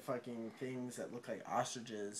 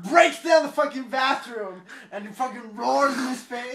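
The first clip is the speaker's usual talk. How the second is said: loud, high, worked-up narration; thrilled, full-volume retelling; excited storytelling; 9 semitones higher, 14 dB louder than usual. Real, not acted. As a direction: belt out the climax of the action.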